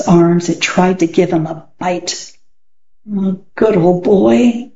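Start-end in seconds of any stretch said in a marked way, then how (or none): 3.1-4.7 sad. Acted. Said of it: "said Alan sadly".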